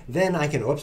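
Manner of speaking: ordinary talk, nothing marked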